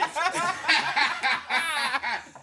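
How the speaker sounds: high, loud burst of laughter. Real, not acted. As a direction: cackle at the memory.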